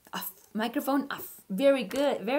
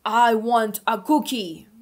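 louder, put-on big man's voice; big deep voice; 8 dB louder than usual. Alfie disguised in coat and hat, big voice. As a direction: puff up like a grown-up in a big coat. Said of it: "in a big, deep voice".

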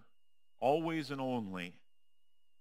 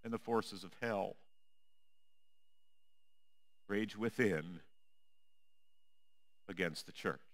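hushed refrain; 4 semitones lower, 8 dB quieter than usual. Acted. Refrain, softest take.

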